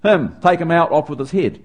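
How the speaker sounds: hammed-up medieval king ordering an execution; imperious king. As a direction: bark the order like a tyrant king. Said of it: "the king would go".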